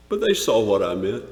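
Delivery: ordinary delivery, nothing marked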